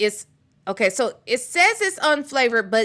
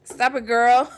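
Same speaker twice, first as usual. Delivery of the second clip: ordinary talk, nothing marked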